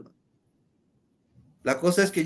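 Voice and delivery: ordinary delivery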